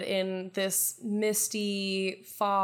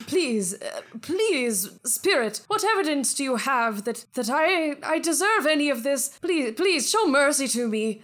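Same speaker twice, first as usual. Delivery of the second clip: higher, louder desperate begging; pleading miser; 5 semitones higher, 5 dB louder than usual. Acted. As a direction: beg the spirit for mercy on your knees.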